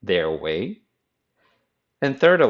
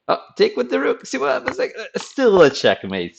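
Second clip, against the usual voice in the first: much higher, exasperated cry; mock frustrated whine; 3 dB louder than usual. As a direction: whine as the losing player. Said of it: "you can say".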